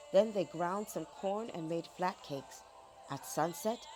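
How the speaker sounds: plain reading voice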